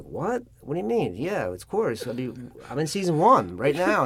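high-pitched retold disbelief; incredulous. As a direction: protest as if they've missed the obvious. Cocky actor disbelieves agents' brush-off.

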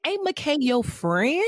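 slow, high, drawn-out disbelief; incredulous question. Real, not acted. Podcaster asks about Nate's friend, slow and incredulous. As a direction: stretch it out, baffled. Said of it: "rhetorical question".

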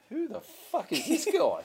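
high-pitched, baffled outburst; mock disbelief. Rich crowd reacting to Aussie one-liners, mock disbelief.

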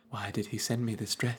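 inner monologue. Retold or imitated. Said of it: "doing her French internal monologue".

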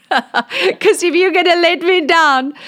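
high, loud laugh through the words; high laughing mock warning. Real, not acted. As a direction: laugh at your own cheek. Laughing threat to God.

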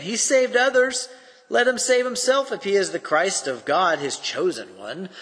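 mock him from below the cross; scoffing rulers. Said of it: "the rulers scoffed at him".

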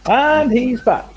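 high, loud announcer reveal; excited announcement. Real, not acted. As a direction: announce it like a big return. Host announces returning guest.

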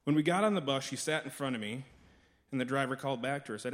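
ordinary talk, nothing marked

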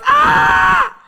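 high piercing shriek of horror; terrified scream. Retold or imitated. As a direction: scream as if struck by a horrific vision. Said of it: "I screamed out in the prayer service".

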